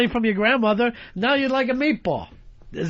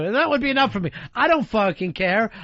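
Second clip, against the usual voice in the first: usual speaking voice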